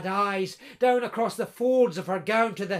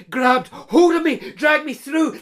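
sudden alarm; 6 semitones higher, 10 dB louder than usual. Acted. Katrina recounting being seized.

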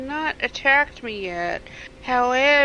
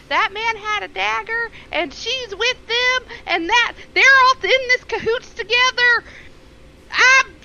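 high, indignant accusation, building louder; scandalized noble; 11 semitones higher, 3 dB louder than usual. Acted. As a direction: make a scene, shrill with outrage. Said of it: "I'm gonna make a fucking scene".

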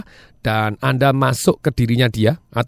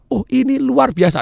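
overexcited; 10 semitones higher than usual. Shown as a demonstration. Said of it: "Anda nggak bisa".

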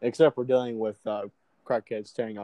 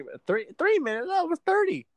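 incredulous. Real, not acted. Friend mocking the claimed time.

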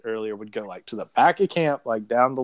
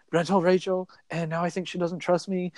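higher-pitched imitation of someone fretting; mock-anxious confession, voice raised in pitch; retold freak-out; 5 semitones higher than usual. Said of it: "like, freaking out".

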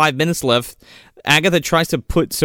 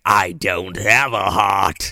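lower, slow villain drone; deadpan villain; 6 semitones lower, much slower than usual. Retold or imitated. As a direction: drag it out cold and heavy. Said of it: "And she's like".

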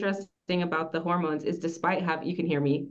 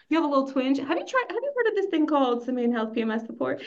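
high, bright pitch voice; eager salesperson; 7 semitones higher, faster than usual. Retold or imitated. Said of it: "I'm like".

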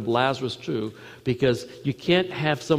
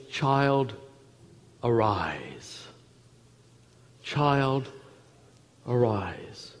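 softly and lower, a gentle summons to wake; tender call; 4 semitones lower, 7 dB quieter, much slower than usual. Acted. Jesus to the dead girl, calling her softly awake. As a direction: call her back gently, low and quiet.

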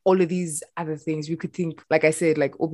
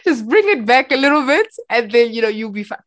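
higher, louder retold plea; urging family member; 5 semitones higher, 5 dB louder than usual. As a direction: imitate family pleading to ease up. Voicing family urging her to slow down.

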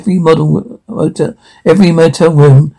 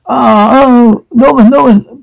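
high-pitched cries for help; acted distressed cry; 8 semitones higher than usual. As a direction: cry out the name in mock distress. Acted cries to Norman during attack.

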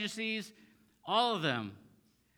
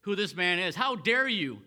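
indignant outburst, louder and higher; mad, frustrated; 4 semitones higher, 6 dB louder, slower than usual. Retold or imitated. Pharisees rebuking the healed man, mad and frustrated. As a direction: lash out, slowing on "how dare you". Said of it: "They're mad. They're frustrated".

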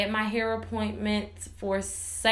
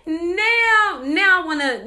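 high, loud burst through laughter; laughing, fired up; 7 semitones higher, 5 dB louder than usual. Real, not acted. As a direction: cackle into a worked-up cry. Laughing while recounting urge to fight.

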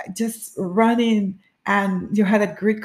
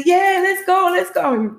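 high, loud, excited yell; rallying shout; 11 semitones higher, 5 dB louder than usual. Retold or imitated. Runners holding hands near the end, rallying cry. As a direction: whip up a last push.

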